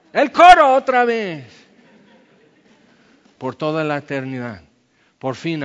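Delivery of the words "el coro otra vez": excited loud call. Pretend. Calling for encore chorus.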